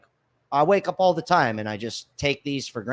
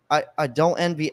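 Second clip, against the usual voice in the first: ordinary delivery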